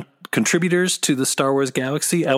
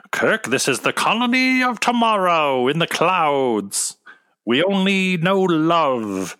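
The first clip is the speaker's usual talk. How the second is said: Star Trek alien leader; 5 semitones higher than usual. Pretend. Star Trek colony leader parody.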